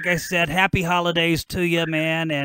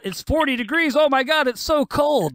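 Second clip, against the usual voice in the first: mock whiny complainer; 7 semitones higher than usual. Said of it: "they'll talk about".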